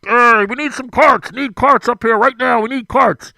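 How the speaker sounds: loud, high call over a loudspeaker; loudspeaker yell. Retold or imitated. Old starter yelling carts over the loudspeaker, loud call. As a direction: bark it into the PA. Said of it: "he's on the microphone".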